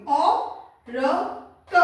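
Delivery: औरत in slow separate sounds, au, ra, ta; syllable by syllable. Shown as a demonstration. Teacher spelling out for pupils, औरत slowly sound by sound. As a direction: break औरत into spaced syllables. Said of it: "दो मात्रा होगी".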